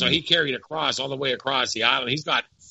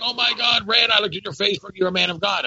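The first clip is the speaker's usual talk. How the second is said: excited friend; 6 semitones higher than usual. Retold or imitated. Friend amazed to find Rand is a man of God, excited. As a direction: gush in amazement, imitating the friend. Retold greeting from old friend.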